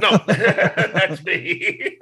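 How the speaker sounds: laughing through the words; chuckling as he owns up. Real, not acted. Laughs after joke denial.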